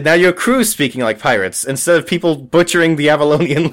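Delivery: ordinary talk, nothing marked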